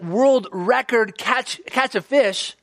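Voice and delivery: normal talking voice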